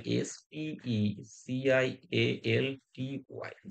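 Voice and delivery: slow letter-by-letter dictation; spelling out. Shown as a demonstration. Teacher correcting a misspelled word, spelled out slowly. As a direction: give each letter its own space.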